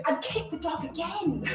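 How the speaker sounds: soft laugh. Real, not acted. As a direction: laugh it off softly. Laughing at kicking the dog.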